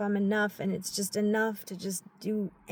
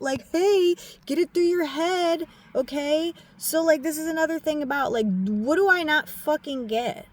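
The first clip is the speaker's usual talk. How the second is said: sharp, much louder and higher; scolding herself; 7 semitones higher, 6 dB louder than usual. Real, not acted. Telling herself to get it through her head, loud scolding. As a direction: yell the lesson at yourself.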